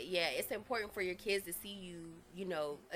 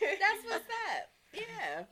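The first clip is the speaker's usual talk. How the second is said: laughing slowly; much slower than usual. Real, not acted. Laughing agreement.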